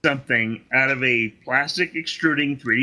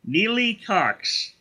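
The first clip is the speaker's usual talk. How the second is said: slow, high, savoring the name; mock-savoring the name slowly; drawn-out name; 5 semitones higher, slower than usual. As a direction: stretch each word out for the joke.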